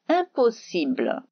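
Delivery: ordinary delivery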